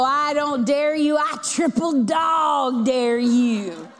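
slow, loud, goading shout; loud triple dare. Retold or imitated. Leanne dares Connie to drop the almond, slow goading shout. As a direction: push her into it, slow and loud. Daring friend.

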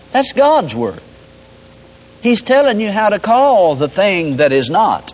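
ordinary delivery

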